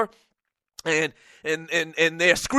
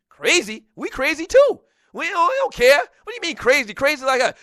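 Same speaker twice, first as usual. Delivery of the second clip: indignant retort; 7 semitones higher than usual. Retold or imitated. Host's indignant retort to callers.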